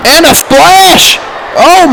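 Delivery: high, slow excited shout; excited call. Real, not acted. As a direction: call the big splash. Big move call.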